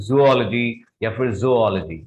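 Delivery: zoology as "zu-OL-uh-jee", then "zo-OL-uh-jee"; correct pronunciation. Shown as a demonstration. Teacher giving the right pronunciation, carefully modelled. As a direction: offer both forms of zoology, stressing OL. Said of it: "The right pronunciation of this word is".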